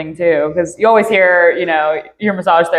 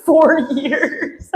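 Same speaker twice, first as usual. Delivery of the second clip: laughing; 6 semitones higher than usual. Real, not acted. Laughing about the neck.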